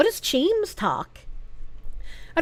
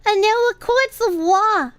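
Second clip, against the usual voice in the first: put-on higher, cutesy baby-talk uwu voice; 5 semitones higher than usual. Streamer reading a film monologue on request, uwu voice. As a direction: read it in a high uwu lisp. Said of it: "In an uwu voice".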